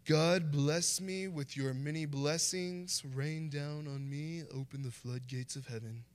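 mock-solemn prayer in King James style. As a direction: ham up an old-fashioned holy prayer. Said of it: "make it more King James Version".

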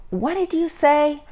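high-pitched questioning call; calling over noise. A narrator's reading. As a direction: ask as if straining to hear. Can't hear in noisy park.

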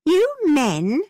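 high-pitched exclamation of protest; indignant cry. A narrator's reading. Isabella scolds James over changing partners, crying out. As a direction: cry out in exasperation. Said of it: "cried Isabella".

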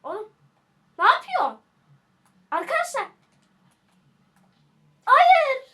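high cries building to a loud shout; alarmed outcry. Real, not acted. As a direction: protest loudly as it all goes wrong. Losing in the game.

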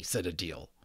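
ordinary delivery

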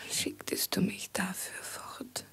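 abandoned, hushed reproach; whisper. Acted. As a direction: whisper it to yourself, heartbroken. Abandoned woman whispering alone.

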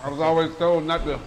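talking through a mouthful; mouth full. Real, not acted. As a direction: speak around a bite of food. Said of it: "talk with your mouth full".

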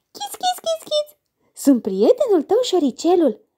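very high, squeaky mouse voice; thin little voice. Acted. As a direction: squeak like a tiny cheerful mouse. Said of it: "vocea subțire".

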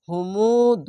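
slow, long-drawn chanted syllables; tajweed recitation. Shown as a demonstration. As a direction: stretch every sound in recitation. Recites the phrase.

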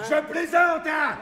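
teasing shout. Acted. Gambler teasing the loser.

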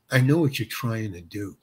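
low, soft knowing jab; with attitude. Retold or imitated. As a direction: imitate a quiet put-down, pitched low. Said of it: "with an attitude".

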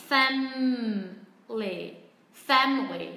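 "family" as FAM-lee, middle syllable gone; two syllables. Shown as a demonstration. Teacher imitates native speakers, two-syllable FAM-lee. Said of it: "sẽ chỉ còn hai âm tiết".

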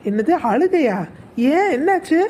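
loud, high shocked question; daughter-in-law startled to hear her mother-in-law cried; alarmed surprise. Acted. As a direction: jolt up in alarm.

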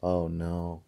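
ordinary delivery, nothing marked

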